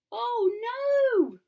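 high, loud "oh no"; dismayed cry. Acted. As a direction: exclaim in dramatic alarm. Dismay at story turn.

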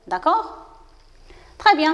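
usual speaking voice

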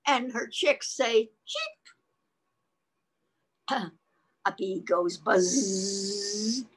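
animated reading with drawn-out animal sounds; lively read-aloud for a child; picture-book reading. Acted. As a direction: play up each animal word.

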